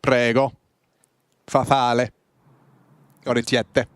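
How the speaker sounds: put-on Italian accent. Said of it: "I can say it in Italian accent".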